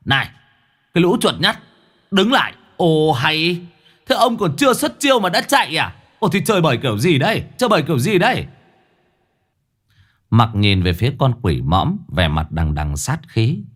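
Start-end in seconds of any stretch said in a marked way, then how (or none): 0.0-8.5 high, swaggering, mocking jeers; cocky bluster. Mõm brags at the retreating ghosts, cocky and high. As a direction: puff up and jeer at the fleeing ghosts. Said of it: "nó sấn sổ".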